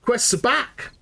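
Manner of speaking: delighted surprise. Real, not acted. Trader's quests reappear.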